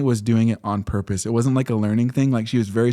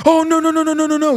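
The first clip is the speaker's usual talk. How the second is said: much higher and louder, frantic no's; panicked kid; 4 dB louder, faster than usual. Retold or imitated. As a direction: squeal it like a kid scrambling onto his desk. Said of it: "a kid, he's like".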